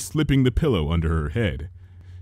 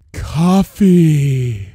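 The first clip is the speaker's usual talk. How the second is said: louder, slow, blissful stretched word; drawn out; 5 semitones higher, 7 dB louder than usual. A narrator's reading. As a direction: savour every syllable. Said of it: "She drew the two syllables out".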